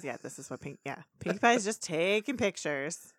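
normal talking voice

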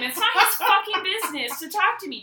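angry rant. Real, not acted. Ranting about creepy driver.

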